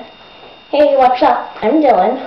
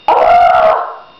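frustrated yell. Real, not acted. Vlogger reenacting losing radio contest, frustrated yell. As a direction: let out a fed-up cry.

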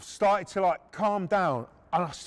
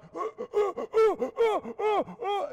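high, repeated gasping; acted breathless whooping gulps; winded gasps; 12 semitones higher than usual. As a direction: imitate fighting for breath. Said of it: "I'm like".

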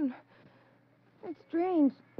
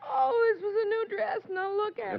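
crying; 5 semitones higher than usual. Acted. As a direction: whimper over the ruined dress. Woman crying over ruined dress.

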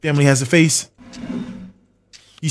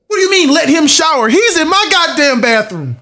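high, indignant exclamation; exasperated outrage, stretched out at the end; outraged protest; 8 semitones higher than usual. Real, not acted. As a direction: protest in high-pitched disbelief. Protesting intruder in his bathroom.

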